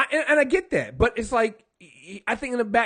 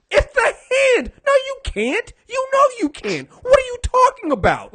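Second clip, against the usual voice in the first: much higher, whimpering mock sob; mock whiny crying; 5 dB louder than usual. [name] refusing to leave, in a hammed-up tearful whine. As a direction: ham up a tearful protest, pitched way up.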